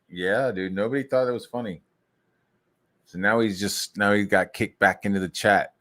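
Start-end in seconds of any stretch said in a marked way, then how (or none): none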